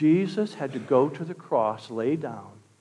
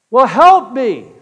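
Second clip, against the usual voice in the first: high, loud, drawn-out demand for rescue; shouted plea; 7 semitones higher, 13 dB louder, slower than usual. Acted. Hanging man begs God for rescue, shouting. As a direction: holler it up to the one above.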